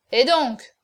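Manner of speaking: loud, grumpy retort; bad-tempered. A narrator's reading. Cocardas objects to the hunchback's order, bad-tempered. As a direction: snap back in a bad mood. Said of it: "fit Cocardas avec mauvaise humeur".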